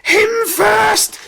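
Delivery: very high, loud scream; panicked high-pitched yell; screamed demand. A narrator's reading. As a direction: scream to shove someone else forward. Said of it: "screamed Henry".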